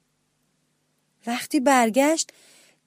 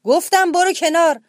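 higher, louder repeated roar; lion roaring louder; 7 semitones higher, 8 dB louder than usual. A narrator's reading. Lion repeating the order, roaring harder. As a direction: bellow the repeat, losing patience. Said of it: "شیر نعره زد".

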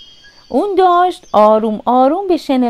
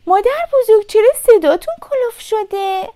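put-on high, surprised girl's voice; 9 semitones higher than usual. A narrator's reading. Little Red questions grandmother's changed voice, surprised. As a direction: ask in high-pitched puzzlement. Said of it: "با تعجب گفت".

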